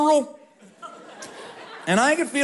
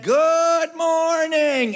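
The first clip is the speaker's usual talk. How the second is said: cheery morning greeting; 8 semitones higher, much slower than usual. Retold or imitated. Camp counselor Wally jumps out of bed, high slow cheery greeting. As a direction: sing it out like a chipper early riser. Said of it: "So he said".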